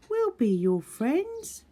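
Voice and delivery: high, slow and kind promise; Little Beaver voice. Acted. Little Beaver promising the otter friendship, high gentle voice.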